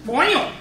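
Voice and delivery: OIL blurted high and loud as "OY-ul"; mock pronunciation. Mocking the student's pronunciation again.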